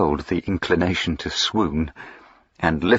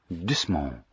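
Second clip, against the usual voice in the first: soft; 10 dB quieter than usual. Acted. Soothing instruction.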